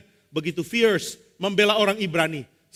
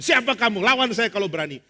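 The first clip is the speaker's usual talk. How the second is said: mock bravado, louder and higher; very bold; 4 semitones higher, 4 dB louder than usual. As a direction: challenge like someone with backing. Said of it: "berani sekali mengatakan". (menantang dengan sangat berani)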